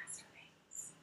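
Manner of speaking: hushed, as if it were a dirty word; mock whisper. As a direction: drop to a secretive whisper for effect. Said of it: "somebody has to whisper it".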